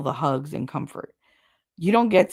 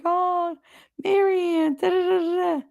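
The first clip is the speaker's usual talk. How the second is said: much higher, mocking sing-song gush; gushing relatives; 12 semitones higher than usual. Mocking her relatives' fake sweetness, high and sing-song. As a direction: overdo the sugary relatives until it almost cracks.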